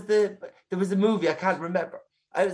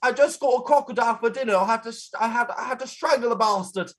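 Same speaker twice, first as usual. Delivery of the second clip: higher, hammed-up Australian accent; 4 semitones higher than usual. Shown as a demonstration. Co-host having another go on request, put-on Australian accent. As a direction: tell it in a rough Aussie accent. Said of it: "in Australia".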